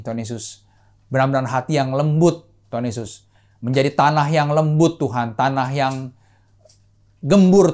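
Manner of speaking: steady, earnest prayer voice; heartfelt prayer. Acted. Pastor praying for the congregation, earnest and steady. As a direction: pray on behalf of the whole church.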